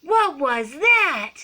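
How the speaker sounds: high, loud, drawn-out amazement; astonished exclaiming. A narrator's reading. Kitten shot past his mirror, loud slow astonishment. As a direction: stretch it out in wonder. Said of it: "exclaimed Skippy John Jones".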